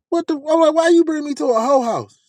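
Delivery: very high, incredulous question; mock-appalled high voice; retold shock. Acted shock at the house.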